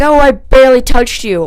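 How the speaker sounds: high, loud mock denial; retold defensive protest. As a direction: imitate him protesting innocence loudly.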